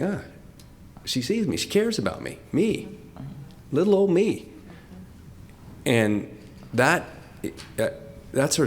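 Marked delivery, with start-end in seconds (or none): 0.0-4.5 a high, touched, lightly voiced wonder; touched inner voice. Imagined thought when Alexis looks at you, touched wonder. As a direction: feel seen and marvel at it. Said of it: "And you think".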